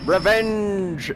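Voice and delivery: loud, high cry of revenge; rising shout of glee; gloating triumph. Real, not acted. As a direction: crow over the beaten enemy. Gloating over beaten enemies.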